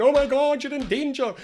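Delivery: high-pitched mock panic; panicked alarm. Emotional mind warning someone of danger, in mock panic. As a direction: sound a sudden, overblown alarm.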